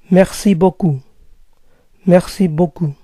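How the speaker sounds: Merci beaucoup with stress on merci, falling; French merci beaucoup. Shown as a demonstration. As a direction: thank like a French speaker. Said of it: "Muchas gracias".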